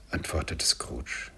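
ordinary delivery, nothing marked

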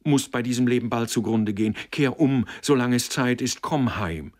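loud, high urging; fierce, insistent outburst; wild cry. A narrator's reading. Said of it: "rief sie wild".

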